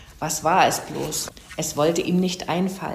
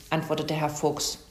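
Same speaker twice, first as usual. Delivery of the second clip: plain reading voice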